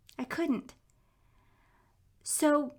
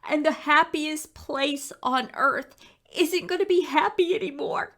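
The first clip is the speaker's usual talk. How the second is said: upset son; 6 semitones higher, 3 dB louder than usual. Retold or imitated. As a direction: voice her son mourning Disney World. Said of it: "he's like".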